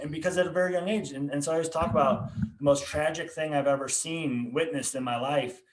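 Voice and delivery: normal talking voice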